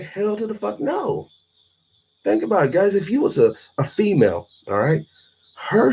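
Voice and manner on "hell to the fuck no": higher-pitched mocking refusal; imitating a woman's higher, scornful "no"; mock woman refusing. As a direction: play a woman flatly turning him down, pitched up. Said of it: "they're like".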